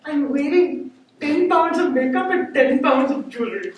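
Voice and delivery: high put-on wife voice; protesting wife. Bride to husband wanting to lift her, high-voiced protest.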